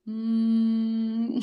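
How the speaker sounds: steady buzzing hum through closed lips; bee hum. Shown as a demonstration. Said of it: "that's how I do".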